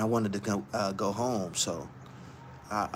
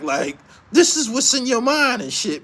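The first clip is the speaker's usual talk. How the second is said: ordinary talk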